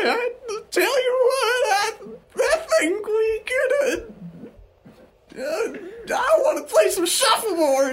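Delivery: Sherry Ovaltine. Acted. Wife telling husband her cruise plans, very high old-lady voice. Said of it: "Should I do a voice?".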